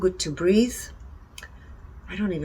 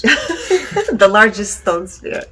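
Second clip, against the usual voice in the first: laughing; 4 semitones higher, 11 dB louder, much slower than usual. Real, not acted. Laughing over hard names.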